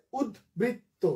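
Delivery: উদ্বৃত্ত slowly as ud, brit, to; syllable by syllable. Shown as a demonstration. Teacher introducing the last example, syllable by syllable. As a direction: divide উদ্বৃত্ত into three beats. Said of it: "তিনটা".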